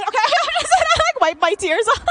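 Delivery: laughing high and loud through the words. Real, not acted. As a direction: crack up at her own story. Laughing at own story.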